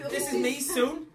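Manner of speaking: put-on Sue's accent. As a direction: lift it into Sue's jokey accent. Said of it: "in the accent of Sue".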